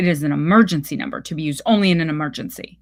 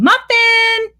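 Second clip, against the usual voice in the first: high, carrying call across the lawn; calling the dog; 7 dB louder, much slower than usual. Acted. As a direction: call out to the dog, much higher and louder. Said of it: "the woman calling".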